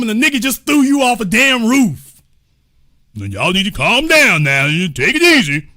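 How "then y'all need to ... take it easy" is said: lower, dismissive boss voice; mock foreman. Foreman brushing off the thrown worker, lower and dismissive. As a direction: talk down to them like a smug supervisor.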